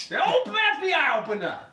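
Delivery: loud, high police shout; mock FBI shout. Agent at the door yelling to be let in, mock shout. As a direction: bang on the door and yell like an agent. Joke about FBI raid.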